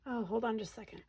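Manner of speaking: ordinary delivery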